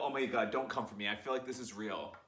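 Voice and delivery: ordinary delivery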